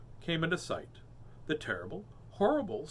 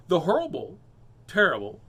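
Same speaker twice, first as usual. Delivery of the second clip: usual speaking voice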